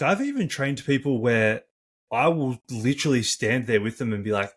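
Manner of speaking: ordinary talk, nothing marked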